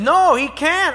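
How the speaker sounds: high, loud protest; insistent child. Retold or imitated. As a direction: insist like a small boy.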